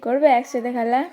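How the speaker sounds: ordinary talk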